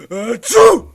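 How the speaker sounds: a sudden explosive sneeze; loud sneeze. Acted. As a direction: sneeze sharply. Gorilla sneezes mid-sentence.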